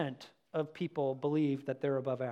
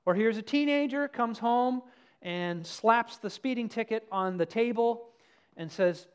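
normal talking voice

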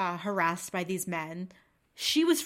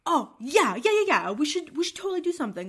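high, louder sudden enthusiasm; mock eager; 8 semitones higher, 7 dB louder than usual. Spud joining his friends, high mock eagerness. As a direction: fake it like a latecomer hero. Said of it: "look at them like".

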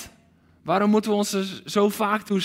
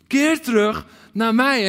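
louder, slow, raised call of Keer; heart cry; 3 semitones higher, 5 dB louder than usual. Acted. Preacher voicing God, calling his people back loudly. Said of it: "die hartschreeuw".

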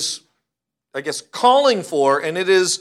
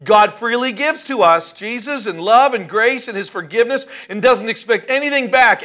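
loud, high preaching with "doesn't" stressed; rousing preacher line; 6 semitones higher, 7 dB louder than usual. Shown as a demonstration. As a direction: sell it like a crowd-pleasing sermon line. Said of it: "I could say to you in the right context".

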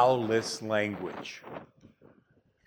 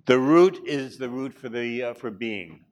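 normal talking voice

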